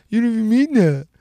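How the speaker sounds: high-pitched imitation of an upset kid; upset son. Upset son answering his dad.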